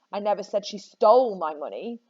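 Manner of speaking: "stole" made the strongest word; stress on STOLE. Shown as a demonstration. Teacher shows students how meaning shifts, stressing "stole". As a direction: punch "stole" mid-sentence. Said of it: "where on earth you put the emphasis".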